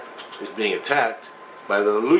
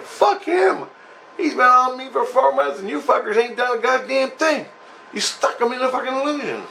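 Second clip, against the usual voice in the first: angry retort; 11 semitones higher than usual. Retold or imitated. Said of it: "I'm like".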